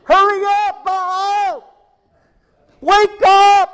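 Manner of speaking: high, loud yell at a sleeping god; screaming, yelling. Acted. Priests of Baal shouting at their god, high loud yelling. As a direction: holler it up high and loud, wake him up. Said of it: "they're screaming, yelling, hollering".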